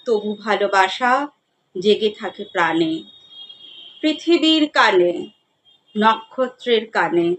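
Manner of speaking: measured poem reading with long pauses between lines; poetry recitation. Acted. Reciter presenting Jibanananda Das's poem Sahaj to listeners, poetry recitation. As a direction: recite with lifted line openings, letting stanza endings sink.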